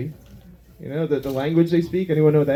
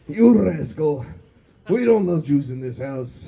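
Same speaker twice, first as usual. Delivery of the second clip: lightly voiced grumpy wife; slower than usual. Wife scolding husband in the kitchen, lightly voiced nag. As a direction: scold him mildly like a cranky old wife. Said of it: "She'll stumble into the kitchen".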